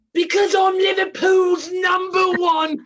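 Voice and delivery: high, shouted Scouse catchphrase; Liverpool accent. Shown as a demonstration. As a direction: proclaim it loudly in a Liverpool accent. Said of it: "Can you do the accent".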